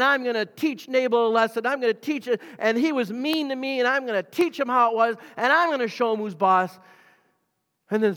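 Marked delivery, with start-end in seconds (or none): 0.0-6.8 higher, louder, fuming revenge speech; steaming, he's got it all lined up; worked-up David. Acted. David rides down rehearsing his revenge, fuming and raised.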